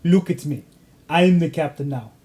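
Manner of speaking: lightly voiced film captor, slightly accented; a hint of a pirate's foreign accent. Host joking around as with Lauren, light pirate accent. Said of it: "I always joke around".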